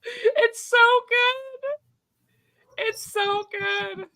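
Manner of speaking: drawn-out, very high gush. Real, not acted. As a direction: stretch it out in delight. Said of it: "gushing about the scene".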